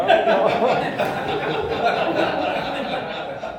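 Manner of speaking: a big, high burst of laughter; loud high laugh. Real, not acted. Laughing at the memory in front of the room, loud and high. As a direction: let the laugh take over.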